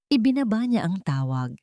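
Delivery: ordinary delivery, nothing marked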